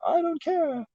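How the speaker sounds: very high falsetto mocking voice; mock carefree Leno. Mocking Leno to chat in a high falsetto. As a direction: squeal it out like a carefree fool. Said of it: "just being like".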